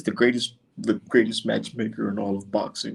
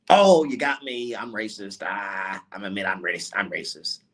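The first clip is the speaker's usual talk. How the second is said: mock confession; 6 semitones lower than usual. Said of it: "The fuck is that".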